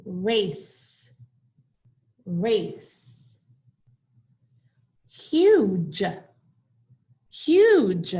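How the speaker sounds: isolated model words like "Race" and "Huge", each final sound drawn out or released; stressed ending sounds. Shown as a demonstration. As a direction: say each word alone, lingering on endings as in "Nice". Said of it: "Listen to those ending sounds".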